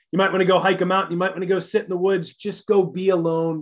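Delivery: ordinary talk, nothing marked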